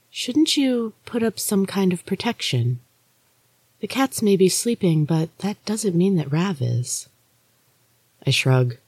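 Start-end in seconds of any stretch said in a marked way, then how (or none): 0.1-7.1 higher, softer voice of a ghostly younger sister; Maria's ghostly voice. Acted. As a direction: lift it and soften it, an eerie sister warning. Maria speaks in the story.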